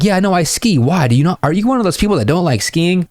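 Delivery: imitating the girl asking if he hates skiing; girl's voice. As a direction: lift the voice to play the girl. Voicing the girl's reply.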